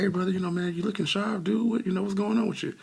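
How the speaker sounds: high-pitched, lively retold compliment; hyped greeting. Worker greets the sharp-looking brother at drive-thru, hyped-up. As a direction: hype up a brother, imitating your own greeting.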